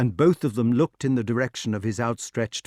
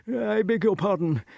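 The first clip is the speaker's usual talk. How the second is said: high, flustered apology; alarmed king backing away politely; trembling king; 9 semitones higher than usual. A narrator's reading. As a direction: excuse yourself high and nervously. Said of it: "the king trembled very much".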